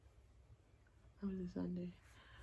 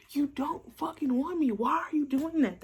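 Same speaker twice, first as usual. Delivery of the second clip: mock outraged guy; 7 semitones higher, 6 dB louder than usual.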